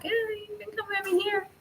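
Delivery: high, soft, quick little voice; softly ushering someone aside, pitched right up; gentle coaxing. Acted. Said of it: "push it to the side gently and be like".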